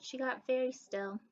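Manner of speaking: plain reading voice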